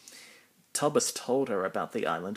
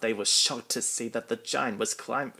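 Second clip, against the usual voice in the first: plain reading voice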